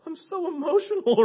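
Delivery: high, overcome prize winner; high-pitched emotional gushing; Betsy. Retold or imitated. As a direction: imitate a winner choked with emotion. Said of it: "you hear Betsy".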